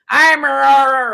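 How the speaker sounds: put-on loud, high, slurred drunken roar; unmodulated drunken yell. Drunk lads talking among themselves at the golf, unmodulated drunken yelling. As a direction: bellow nonsense like a drunk with no volume control. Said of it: "don't really modulate your pitch".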